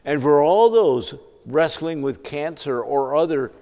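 loud, emphatic sermon delivery; full-force preaching. Real, not acted. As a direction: preach it out to the whole congregation.

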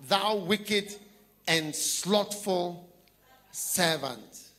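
loud, slow, booming rebuke; loud scriptural condemnation; thundering scripture. Acted. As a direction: boom it out like a judge's verdict. Preacher reciting scripture loudly.

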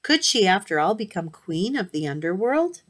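ordinary delivery

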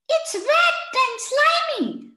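put-on high, slow child voice; child's voice. A narrator's reading. Akif describes frog in high slow child voice. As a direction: sound like an eager little boy. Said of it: "said Munni".

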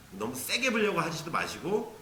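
normal talking voice

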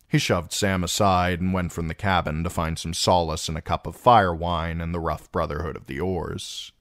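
ordinary delivery

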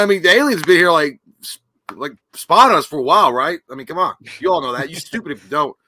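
usual speaking voice

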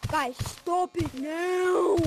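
slow mock scolding. Scolding friends in the game.

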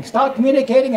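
usual speaking voice

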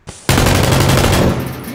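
laughing. Real, not acted. Loud laugh.

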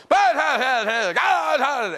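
loud, high angry sputtering; furious grunts. Retold or imitated. Angry customer sputtering at the assistant manager. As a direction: sputter at her in a rage. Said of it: "He's going".

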